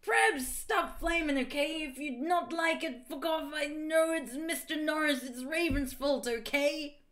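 high-pitched whiny bratty rant; petulant author. Acted. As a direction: author scolding critics in a put-on high voice. Reads author's note in character.

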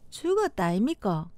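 low-pitched Gyeongsang dialect; 경상도 사투리. Shown as a demonstration. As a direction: say it with a Gyeongsang lilt. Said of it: "경상도". (낮은 경상도 사투리)

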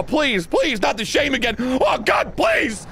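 high-pitched hammed-up pleading; mock begging. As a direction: plead in a high mock wail, rising to the end.

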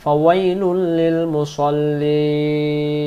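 slow chant; Quran recitation. Acted. Teacher reciting verse slowly before translating. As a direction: hold ফাওয়াইলুল long.

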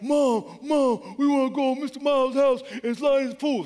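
put-on high, loud child's plea; begging kids. Kids begging mom to go swim, eager child voice. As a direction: whine like excited little kids.

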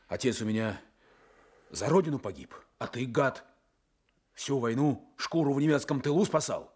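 building from firm to loud, high accusation; growing furious reproach; rising anger. Acted. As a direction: let the bitterness climb to a shout. Voicing his accusation of Timofey.

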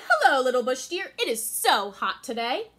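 butter her up like a schemer; sly Anansi. Acted. Anansi sweet-talking Little Bush Deer, sly and loud.

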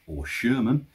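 ordinary talk, nothing marked

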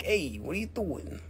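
softly voiced snail with an eyeball; a quiet, lightly acted little challenge; snail's voice. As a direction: speak softly for the staring snail. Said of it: "It's looking at you being like".

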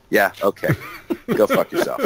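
laughing mock retort; Chief talking back. As the Chief answering the Admiral, a laughing retort. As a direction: toss it back with a laugh breaking through. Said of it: "I'd be like".

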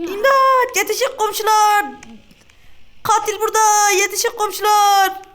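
loud, high cries to the neighbours; mock panicked calling; calling for help. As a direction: yell out for the neighbours.

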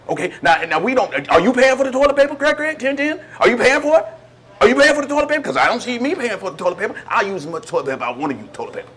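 loud, high, rising outrage over toilet paper; indignant rant. Acted. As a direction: defend your dignity, getting shriller.

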